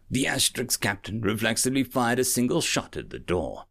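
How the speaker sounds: ordinary delivery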